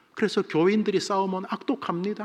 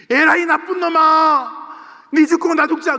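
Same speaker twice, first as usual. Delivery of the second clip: high, loud scolding roar; furious parent yelling; 13 semitones higher, 11 dB louder than usual. Retold or imitated. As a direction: bellow at the child like an outraged parent. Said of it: "부모가 꾸중을 합니다".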